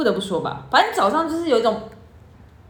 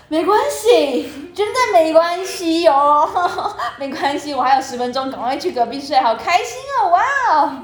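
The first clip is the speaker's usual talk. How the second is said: sarcastic cheer; 4 semitones higher than usual. Retold or imitated. Younger sister faking cheer about the broken sleep, sarcastic. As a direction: pretend to be thrilled while seething. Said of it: "我就是说".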